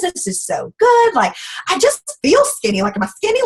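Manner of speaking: high, delighted gushing; retold giddy excitement; thrilled. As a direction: squeal with delight. Gushing over the pink drink.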